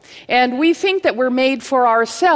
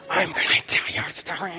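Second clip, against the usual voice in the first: fast, harsh, unvoiced angry whisper; whisper yelling; 4 semitones lower, 6 dB quieter, faster than usual. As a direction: hiss a threat through a tight whisper. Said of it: "doing the whisper yell".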